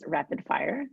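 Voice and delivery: ordinary talk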